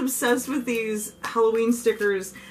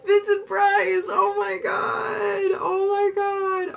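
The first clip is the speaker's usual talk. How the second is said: high, long-drawn fan squeal; overjoyed wail; 11 semitones higher, slower than usual. Real, not acted. Fan receiving a Vincent Price gift, overjoyed wail. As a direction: wail it slowly in sheer delight.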